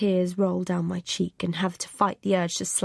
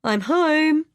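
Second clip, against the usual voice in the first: high, loud greeting; calling out; 8 semitones higher, 7 dB louder than usual. A narrator's reading. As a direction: call it through the house. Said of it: "calls Chris".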